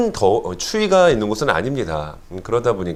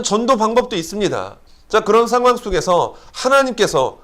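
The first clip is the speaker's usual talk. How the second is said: fervent preaching; 5 semitones higher than usual. Acted. Preaching builds up.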